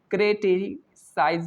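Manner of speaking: Criticise slowly broken into parts; slow syllable demo. Shown as a demonstration. Teacher answering "what will we say", slowly syllable by syllable. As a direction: sound out criticise unhurriedly. Teacher sounding out word for students.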